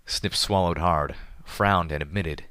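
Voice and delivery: ordinary delivery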